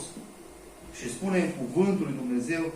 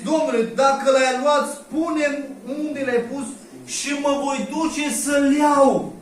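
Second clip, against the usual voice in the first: pleading Mary; 8 semitones higher, 6 dB louder, slower than usual. Acted.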